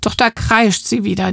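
plain reading voice

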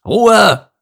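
high loud call for silence; shout. Acted. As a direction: gorilla yelling Ruhe. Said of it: "rief er".

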